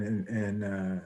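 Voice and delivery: ordinary delivery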